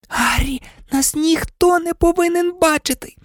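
put-on high-pitched pleading girl's voice; urgent warning. A narrator's reading. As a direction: warn a friend in alarm. Hermione urgently restraining Harry. (тривожне високе застереження)

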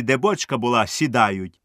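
usual speaking voice

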